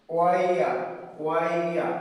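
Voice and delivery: ওয়াইয়া as one smooth ɔɪə glide; triphthong demo. Shown as a demonstration. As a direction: blend ওয়াইয়া into one sound. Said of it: "এটার উচ্চারণ হবে". (ট্রিপথং ওয়াইয়া মসৃণ করে)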